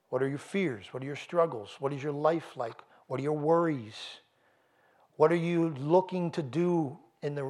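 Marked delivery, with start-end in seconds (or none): none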